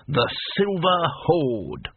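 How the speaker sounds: put-on slow, lightly proud old barbarian; proud naming. A narrator's reading. Cohen repeating his band's name to Rincewind with slow pride. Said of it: "with a touch of pride".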